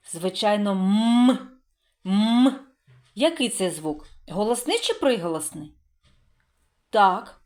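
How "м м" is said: long hummed "М"; isolated first sound. Shown as a demonstration. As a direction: hold "М" alone with lips closed, no vowel. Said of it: "який ви чуєте перший звук?". (протяжний окремий звук "М")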